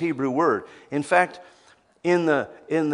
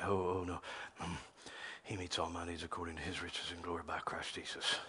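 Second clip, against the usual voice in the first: much softer, low murmured scripture; muttering scripture; 8 semitones lower, 18 dB quieter, faster than usual. Retold or imitated. Believer catching himself, muttering scripture quietly. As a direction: murmur the verse to yourself to calm down. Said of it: "You say".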